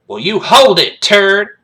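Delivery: loud, high bark of command; stern bellowed order. Acted. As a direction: drill sergeant shouting down a kid. Sergeant orders the kid.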